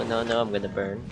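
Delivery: usual speaking voice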